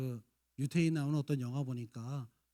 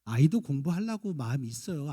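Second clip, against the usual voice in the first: usual speaking voice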